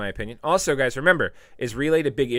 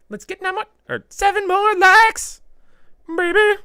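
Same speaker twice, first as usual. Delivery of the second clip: high sing-song plea; 9 dB louder than usual. Acted. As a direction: croon it up high like a hype man. Begging chat for likes.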